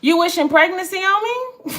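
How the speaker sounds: can't believe what she's reading; incredulous. Real, not acted. Reacting to a viewer comment.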